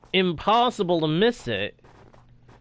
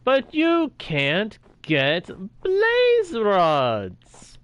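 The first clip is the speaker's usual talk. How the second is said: slow, higher, stretched-out sarcastic moan; drawn-out mock complaint; 7 semitones higher, 4 dB louder, much slower than usual. Real, not acted. Streamer mocking peaceful mode's missing blaze rods, slow and sarcastic. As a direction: drag every word out in exasperation.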